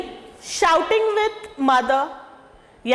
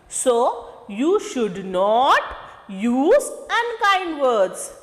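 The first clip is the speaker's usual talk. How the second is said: slow teacher emphasis; much slower than usual. Shown as a demonstration. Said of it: "teacher stresses the rule".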